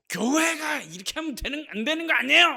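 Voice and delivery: high, indignant yell, acted out; shouted protest. Offended member protesting how church is run, shouting. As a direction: shout the challenge across the church. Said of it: "하고 막 소리치죠".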